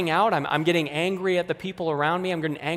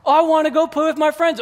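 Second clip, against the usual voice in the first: much higher, loud whining protest; exploding kid; 11 semitones higher, 5 dB louder than usual. Acted. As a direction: wail it at mom, furious and unfair. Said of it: "you can totally explode".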